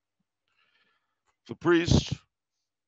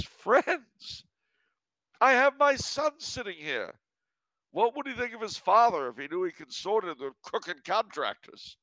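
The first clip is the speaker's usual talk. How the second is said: much higher, righteous mock outrage; indignant dismissal; 10 semitones higher than usual. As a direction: show the crook the door with relish. Father throws out cheating contractor.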